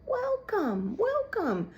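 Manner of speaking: high, loud false cheer; welcoming rat. A narrator's reading. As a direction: greet him like a long-lost friend. Rat greets mouse.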